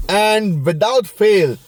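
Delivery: drawn-out, slow, weighty; slow, heavy refrain; slow emphatic. Shown as a demonstration. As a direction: stretch it out like a running gag.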